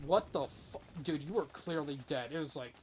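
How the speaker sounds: ordinary delivery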